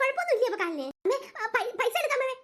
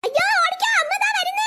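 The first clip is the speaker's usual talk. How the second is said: panicked shout; 9 semitones higher than usual. Acted. Child yells warning that mother is coming. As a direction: shout to run away. Kids flee angry mother.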